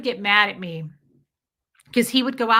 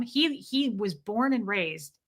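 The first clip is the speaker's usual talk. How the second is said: ordinary talk, nothing marked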